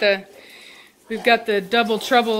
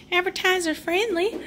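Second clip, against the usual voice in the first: mock prim voice; 9 semitones higher, 3 dB quieter than usual. Real, not acted. Embarrassed joke after saying milkers.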